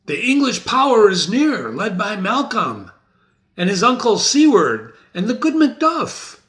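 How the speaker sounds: rally the men with the news; Menteith announcing. Acted. Menteith voiced high and loud.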